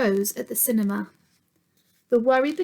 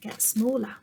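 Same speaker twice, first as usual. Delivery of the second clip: ordinary delivery, nothing marked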